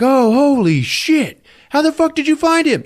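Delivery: shocked disbelief. Retold or imitated. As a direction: gasp out the question in shock. Group shocked at finding T'Challa.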